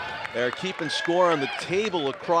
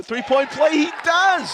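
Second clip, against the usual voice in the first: much higher, louder exclamation; excited play-by-play call; 13 semitones higher, 7 dB louder than usual. Real, not acted. Announcer calling a completed three-point play, excited shout. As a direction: burst out as the shot drops. Live call of successful shot.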